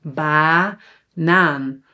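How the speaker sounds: BANAAN with the first A long and open; slow, syllable-by-syllable BANAAN, long A's; long open vowels. Shown as a demonstration. Teacher shows why the first A is long, BANAAN drawn out. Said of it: "it's an A because it's open".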